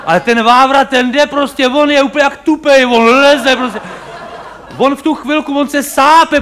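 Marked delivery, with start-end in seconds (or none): none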